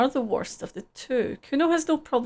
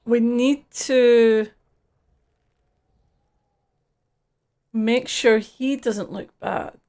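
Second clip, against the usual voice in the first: slow deliberate; 6 dB louder, much slower than usual. Real, not acted. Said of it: "player speaks slowly, deliberately".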